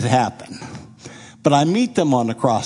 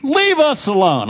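much higher, louder command; commanding order; 12 semitones higher, 4 dB louder than usual. Retold or imitated. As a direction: order the crowd away firmly. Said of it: "So I told everybody".

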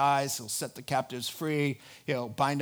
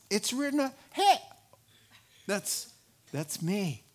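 put-on young Jesus realizing; 6 semitones higher than usual. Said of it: "there, he says".

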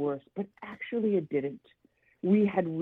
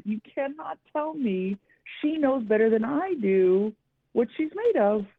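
higher-pitched, mildly indignant retort; retold screaming match; 5 semitones higher than usual. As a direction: re-enact putting the therapist in her place. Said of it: "it turned into somewhat of a screaming match".

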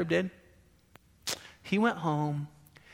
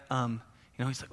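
ordinary talk

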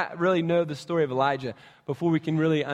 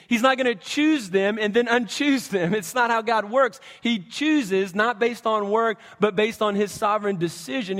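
raised, louder preaching push; preaching emphasis; 5 semitones higher, 3 dB louder than usual. Acted. Pastor insisting to the church, raised preaching. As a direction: drive the point home to the congregation.

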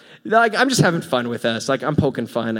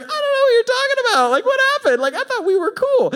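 much higher, fast, baffled "I don't know what you're talking about"; confused poor dude; 3 dB louder than usual. Retold or imitated. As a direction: play the bewildered friend. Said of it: "that poor dude is like".

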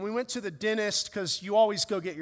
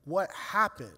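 stunned question; 2 semitones lower, 4 dB quieter than usual. Retold or imitated. Father reeling from wife's call, quietly stunned. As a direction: ask it numbly, barely taking it in. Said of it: "now I gotta go".

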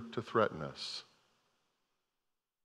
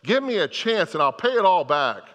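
pleading; 5 semitones higher, 4 dB louder than usual. Retold or imitated. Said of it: "and he begged".

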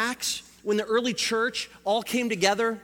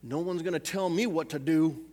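put-on Southern accent; 6 semitones lower than usual. Preacher voicing someone who refuses authority, Southern accent. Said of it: "went into a southern accent".

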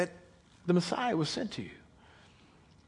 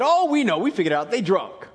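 mocking; 2 semitones higher, 8 dB louder than usual. Crowd scoffing at the disciples, a loud jeer. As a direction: sneer it at them like a heckler.

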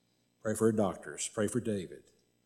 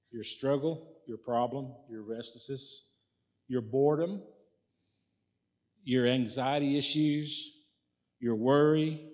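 ordinary talk, nothing marked

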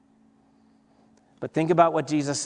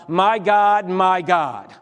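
louder, raised cry of faith; 3 semitones higher, 4 dB louder, slower than usual. Shown as a demonstration. Pastor voices Jesus calling to God, louder cry. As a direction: let it ring out as a cry to God. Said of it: "a deepened faith that can still cry out".

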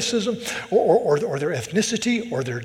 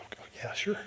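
whispered, offhand agreement; hushed aside; 6 semitones higher, 10 dB quieter, faster than usual. Retold or imitated. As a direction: drop to a near-whisper, as if obvious. Muttered offhand agreement, retold reaction.

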